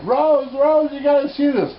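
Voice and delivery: high, loud excited calling out to Rose. Retold or imitated. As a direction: call her in from the kitchen, thrilled. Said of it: "I went".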